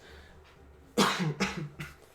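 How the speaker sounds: sputtering cough from water; choking on water. Real, not acted. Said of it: "Sorry, I'm choking on water".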